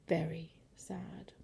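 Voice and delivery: slow, very soft whisper; hushed, sorrowful echo; sad whisper. A narrator's reading.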